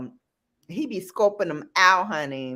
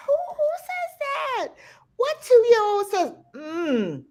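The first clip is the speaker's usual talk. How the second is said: very high squeak of disbelief; incredulous mother. Retold or imitated. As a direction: act out being utterly baffled, way up high. Incredulous mother retelling her reaction.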